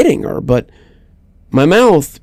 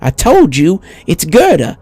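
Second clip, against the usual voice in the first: put-on high old woman voice, a little sharper; higher old lady, firm and stubborn; insistent old woman; 7 semitones higher than usual. A narrator's reading. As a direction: correct him firmly, then soften. Old woman insists on her name.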